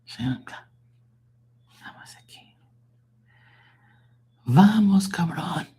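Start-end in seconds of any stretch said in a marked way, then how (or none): 1.8-5.7 high airy whisper, teasing; whispered "Vamos aquí", high and unvoiced; playful whisper. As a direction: breathe the words like a secret joke.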